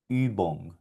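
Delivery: "Übung" softly, with rounded ü; quiet, careful "Übung"; German ü. Shown as a demonstration. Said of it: "Esta palabra sonaría".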